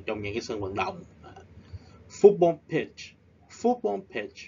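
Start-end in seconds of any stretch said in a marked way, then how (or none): 2.1-4.4 football pitch with FOOT punched high; stress on football. Shown as a demonstration. Teacher drilling students: FOOT stressed high and loud. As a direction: hit the first syllable of football. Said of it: "We stress at the first syllable".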